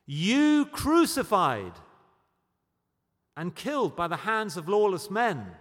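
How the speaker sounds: loud, forceful preacher accusing the crowd; intense accusation. Acted. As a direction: stretch the opening words out, hard and loud. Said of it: "Peter was probably quite an intense guy".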